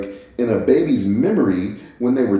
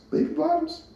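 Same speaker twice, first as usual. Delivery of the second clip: amused surprise; 5 dB quieter than usual. Real, not acted. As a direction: catch your own silly mistake with a high, quiet question. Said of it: "Baby Boss".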